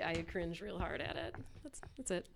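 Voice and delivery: usual speaking voice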